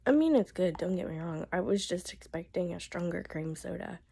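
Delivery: hoarse whisper; barely any voice left; voice giving out. Real, not acted. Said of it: "I'm hoping that my voice will last".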